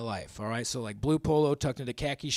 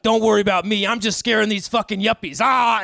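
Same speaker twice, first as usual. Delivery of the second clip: louder, raised voice, gleeful; mock yelling friend; 6 semitones higher, 9 dB louder than usual. Stephanie mid-chase tells comic she's scaring yuppies, yelling. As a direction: yell it while chasing.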